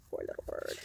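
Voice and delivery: soft, breath-only aside; hushed sympathy. Real, not acted. Reader pitying the bird to children, hushed sympathetic aside. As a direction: murmur it pityingly.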